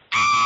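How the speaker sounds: long held mock scream; drawn-out "aah" of madness; imitated scream. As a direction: scream straight out, comically crazed. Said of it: "He's like".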